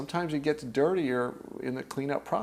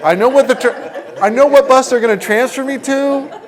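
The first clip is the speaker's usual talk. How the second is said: much louder and higher, laughing through it; 9 semitones higher, 14 dB louder than usual. Real, not acted. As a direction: burst out amused. Laughing at predictable stories.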